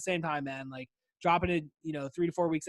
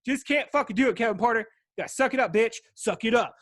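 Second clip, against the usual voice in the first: louder, higher, hyping himself up; mock tough pep talk; 4 semitones higher, 6 dB louder than usual. Host yelling at himself to suck it up, louder and higher. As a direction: bark it like a drill coach at yourself.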